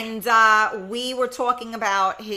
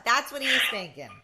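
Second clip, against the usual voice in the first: ordinary delivery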